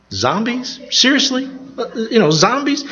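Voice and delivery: high-pitched questioning disbelief; incredulous. Real, not acted. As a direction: ask it as if it's utterly absurd. Mock disbelief at zombie craze.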